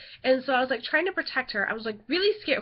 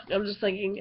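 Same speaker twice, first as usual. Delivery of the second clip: usual speaking voice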